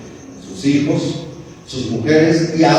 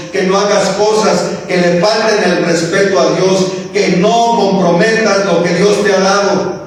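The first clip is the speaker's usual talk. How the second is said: raised, urgent exhortation; fervent preaching; 5 semitones higher than usual. Acted. Preacher urging his flock not to compromise, fervent. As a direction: press the listeners to stay faithful.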